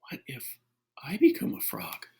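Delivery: hushed, anxious whisper; whispered fretting; worried whisper. Acted. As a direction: whisper the scary thought.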